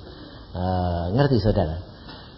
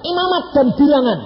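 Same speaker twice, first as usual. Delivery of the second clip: "Imamat dan Bilangan" repeated high and loud; loud incredulous "Imamat dan Bilangan"; surprised echo; 10 semitones higher, 7 dB louder than usual. Retold or imitated. Preacher echoes in surprise.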